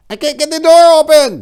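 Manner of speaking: very high, loud desperate scream. Acted. Trapped man yelling at the jammed door, screaming. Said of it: "Diego Barry screamed".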